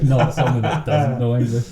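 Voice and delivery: high, loud words through a laugh. Real, not acted. Laughing clarification.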